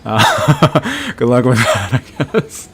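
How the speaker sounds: laughing through the words; real laughter breaking into the line. Real, not acted. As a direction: chuckle it out, amused. Laughing at viewer's dash question.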